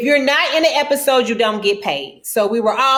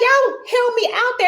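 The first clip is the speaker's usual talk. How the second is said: annoyed; 12 semitones higher than usual. Retold or imitated. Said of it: "but I'm like".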